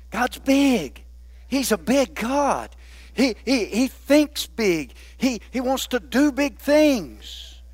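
slow, weighty declaration; preacher slowing for emphasis. Acted. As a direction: lean on each word slowly. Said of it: "slow emphatic preaching".

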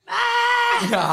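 high shouted "Ah!" with a laugh; retold scream. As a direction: replay the frustrated yell, half laughing. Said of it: "I just screamed at the top of my lungs".

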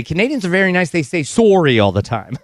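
"sorry" with a rounded Canadian "sore-ee" vowel. Shown as a demonstration. Host quoting how people mock Canadians, Canadian "sorry" as "sore-ee".

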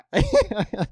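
high chuckle at own joke; real short burst of laughter. Real, not acted. Laughs at own mock quote.

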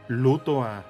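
usual speaking voice

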